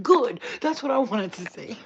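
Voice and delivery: loud, high burst of approval; imitated emphatic approval. Imitating brother hearing the hillbilly insult, loud approval. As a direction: punch it out loudly, as the eager brother.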